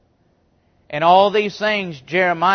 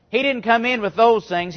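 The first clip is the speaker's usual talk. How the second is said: normal talking voice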